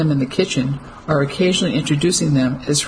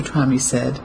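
usual speaking voice

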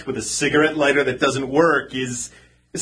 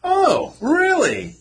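high, mocking surprise; sarcastic; 11 semitones higher, much slower than usual. Man needling woman about her appointment, sarcastic. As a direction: feign being impressed, pitch swooping up then falling. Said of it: "don't be sarcastic".

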